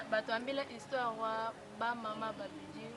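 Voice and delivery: ordinary talk, nothing marked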